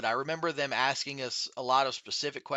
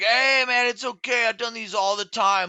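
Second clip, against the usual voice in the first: put-on drunk friend voice; 6 semitones higher, 6 dB louder than usual. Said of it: "like, drunk off his ass".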